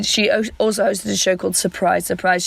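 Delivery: usual speaking voice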